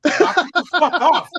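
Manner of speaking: forceful warning. Retold or imitated. Bouncer grabs a man by the head, loud commanding warning. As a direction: pull him up short with a loud order.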